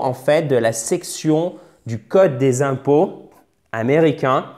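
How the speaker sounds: normal talking voice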